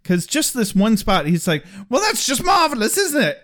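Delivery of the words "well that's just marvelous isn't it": high, fast Monty Python-style British sarcasm; British accent. Retold or imitated. Comparing Giles to a Monty Python sketch, high fast British accent. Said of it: "Monty Python when he was doing the sketch".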